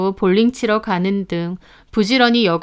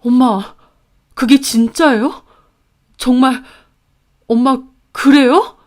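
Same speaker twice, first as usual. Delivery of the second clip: halting, raised, choked-up plea; tearful questioning; 4 semitones higher, 5 dB louder than usual. A narrator's reading. Brother asks mother if it is true, tearful. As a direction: ask in broken pieces, near tears. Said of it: "오빠는 울먹이며 엄마에게 되물었고".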